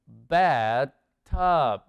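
BATHTUB loud and low, in two separated parts; slow model word. Shown as a demonstration. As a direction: reveal BATH, then TUB, firmly. Teacher reveals and models the answer. (palabra modelo, fuerte y separada)

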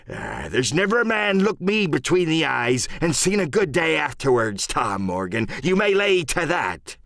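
high-pitched, swaggering sea-dog threat; salty pirate warning, words drawn out; pirate captain Silver, menacing. Acted. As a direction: put the mutineer in his place.

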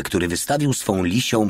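plain reading voice

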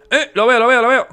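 high, loud excited shout. Real, not acted. Gamer spotting the lost child in the woods, excited shout. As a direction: blurt it out as you spot him.

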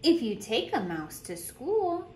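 lively storytime voice, bouncing pitch; animated picture-book reading; reading to children. Acted. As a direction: read it like a teacher at story circle. Reads picture book aloud.

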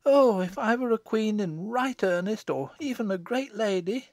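put-on high, wishful village girl; dreaming daughter. Daughter imagining herself a great lady, high wishful voice. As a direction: daydream aloud in a high voice.

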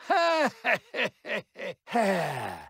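long high maniacal cackle; evil cackle. Acted. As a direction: cackle in triumph. Villain laughs between lines.

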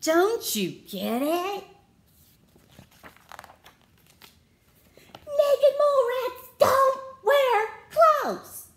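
high, loud and slow, spelling it out; exasperated mole rats. Acted. Mole rats loudly lecturing Wilbur, fed up. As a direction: drill it into him, fed up. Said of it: "said the other mole rats".

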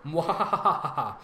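slow, mock villain cackle; evil laugh. Host reacting to the comment about the sister, mock evil cackle.

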